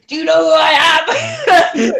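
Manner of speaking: high, loud, slowed mock yell laced with laughter; imitated outraged scream. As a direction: yell it like an offended star, laughing. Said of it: "Mark's screaming".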